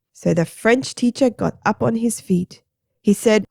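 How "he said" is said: usual speaking voice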